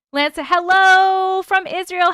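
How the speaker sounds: high drawn-out hello; sing-song greeting. Acted.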